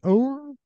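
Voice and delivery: high-pitched mock dog yelp; imitated dog yelp. Imitating the neighbor's hurt dog, high yelp. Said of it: "oh, a yelp, like".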